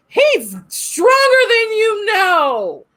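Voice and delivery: high, slow, shouted defiance; defiant cry. Retold or imitated. Girl defying the hotel spirit, slow high shout. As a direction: belt it out like a battle cry.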